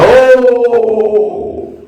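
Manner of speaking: high, startled "oh"; shocked yelp. Retold or imitated. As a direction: jump way up in shock. Shocked when he finishes.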